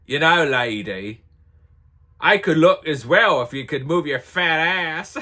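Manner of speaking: put-on loud, cheeky North London English accent. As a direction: call her out in a brash London English accent. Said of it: "with her English accent".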